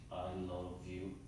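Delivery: low, quiet, toneless declaration of love; lifeless, tense and low; flat, stiff. Shown as a demonstration. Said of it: "my voice and tone is so flat".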